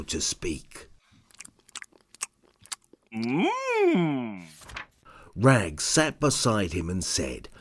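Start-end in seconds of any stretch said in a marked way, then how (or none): 3.2-4.4 high, tremulous squeak with a cry in it; small overwhelmed squeak; squeaky whimper. Acted. Sparky overwhelmed by cheese, squeaky whimper. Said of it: "then squeaked, then shrieked".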